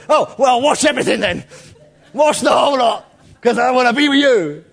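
Peter overdoing it, nearly shouting; over-the-top Peter. Retold or imitated. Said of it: "Peter goes O T T".

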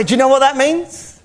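put-on high-pitched Scottish accent, teasing reveal; piping Scottish voice, as a playful punchline; Scottish preacher impression. Preacher delivering his punchline to eager listeners, high Scottish accent. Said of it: "and he'd say".

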